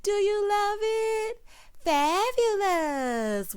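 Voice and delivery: high, singsong, very drawn-out catchphrase; Teresa. Retold or imitated. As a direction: imitate a gushing catchphrase, high and stretched long. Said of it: "remember when Teresa used to say".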